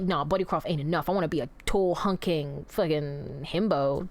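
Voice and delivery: a hint of a dumb tough king wanting to be a himbo; imitating the king. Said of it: "he was like".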